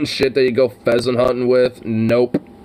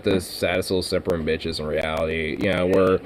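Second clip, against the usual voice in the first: usual speaking voice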